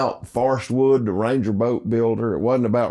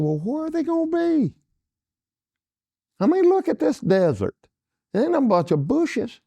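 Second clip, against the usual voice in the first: very high mock scoffing; scoffing disbelief. Dale scoffing at the desert to Parker, high mock disbelief.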